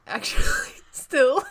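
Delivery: laughing. Real, not acted. Laughing at own correction.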